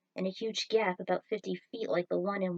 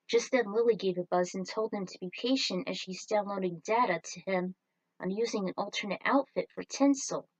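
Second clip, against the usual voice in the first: ordinary delivery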